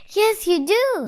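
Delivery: kindly contradicting storybook bear; insistent bear. A narrator's reading. Bear insists to frightened boy.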